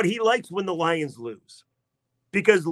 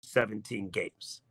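much lower, softer, slow mock-sinister echo; mock ominous; 8 semitones lower, 11 dB quieter, slower than usual. Brother mockingly repeats the stretch, low and slow. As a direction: drag it out like a dark warning.

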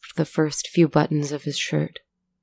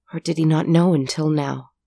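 plain reading voice

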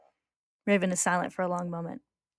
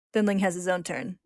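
ordinary talk

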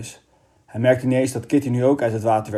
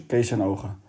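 usual speaking voice

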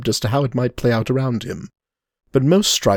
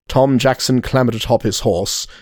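ordinary delivery, nothing marked

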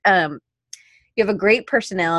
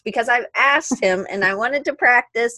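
normal talking voice

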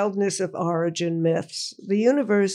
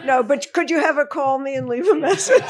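laughing; 8 semitones higher, 3 dB louder, faster than usual. Real, not acted. Joking reply amid laughter.

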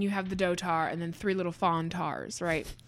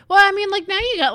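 excited; 13 semitones higher, 11 dB louder than usual. Real, not acted. Excited aside to character.

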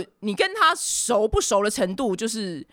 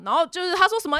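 ordinary delivery

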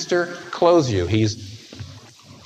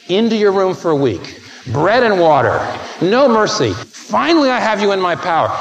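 higher and louder, gloating punishment; mock harsh father; 6 semitones higher, 5 dB louder than usual. The father pounces on his confessing son, mock gloating shout. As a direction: ham up a vindictive dad.

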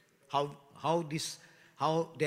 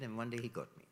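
usual speaking voice

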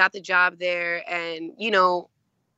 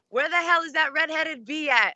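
voiced higher, a mock drunk rant; drunk friend; 7 semitones higher than usual. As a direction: play the tipsy friend hunting for Sarah, pitched up. Drunk friend asking after Sarah.

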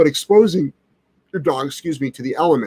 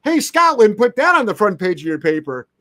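mock announcing; 7 semitones higher than usual.

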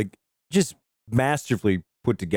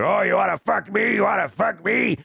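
macho taunt; 7 semitones higher than usual. Retold or imitated. A podcaster retelling a drunk dad taunting his son, in a mock macho shout. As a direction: bellow the challenge like a drunk father. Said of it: "a real macho thing".

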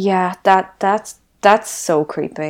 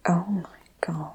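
shocked breathless whisper; 8 dB quieter than usual. Real, not acted. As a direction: gasp then whisper it. Shocked reaction to creepy detail.

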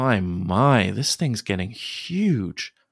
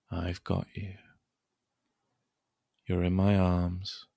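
much softer, hushed lulling; soothing a child; 3 dB quieter than usual. Acted. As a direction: lull him gently toward sleep. Calming child to sleep.

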